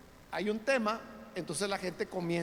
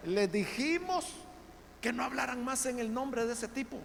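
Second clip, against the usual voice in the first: Sanhedrin scolding; 6 semitones higher, 5 dB louder than usual. Acted. The council scolds the apostles, a hint of indignant rebuke. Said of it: "Les dice".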